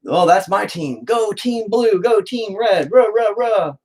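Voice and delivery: mock sports fan. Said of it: "root for our team".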